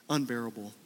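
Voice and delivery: ordinary delivery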